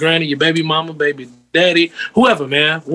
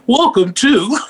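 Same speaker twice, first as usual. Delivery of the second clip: laughing, higher show-intro voice; mock show announcer; 5 semitones higher, slower than usual. Host jokingly introduces cohost's talk show, mock announcer.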